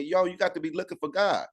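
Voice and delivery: ordinary delivery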